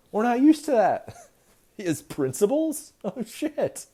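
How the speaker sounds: high, loud mock panic; panicked Republicans. Republicans panicking that Mueller does his job, high and loud. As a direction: squeal it out in alarm.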